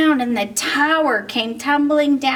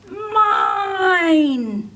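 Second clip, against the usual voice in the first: wailing cry; 7 semitones higher than usual. Acted. Grabby dino wails at his classmates in a tug of war. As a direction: wail it like a toddler losing a tug of war. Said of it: "As he wailed".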